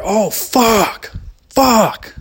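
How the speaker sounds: high, loud imitated cry of shock; pained yell. Dad hit in the eye by turkey, mock pained yelling. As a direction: yell like something just hit your eye.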